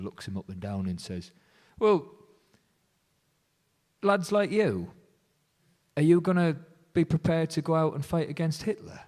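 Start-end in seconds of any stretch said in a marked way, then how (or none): 1.8-9.0 high imitating Churchill, sizing the lad up; a hammed-up Winston Churchill challenge; Churchill impression. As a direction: Churchill grilling the young volunteer, mock Churchill voice. Churchill quoted in the story.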